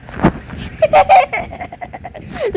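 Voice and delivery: high-pitched burst of giggling; high giggle. Real, not acted. Gamer amused at levelling with every step, high giggle. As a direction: break into a squeaky, rattling giggle.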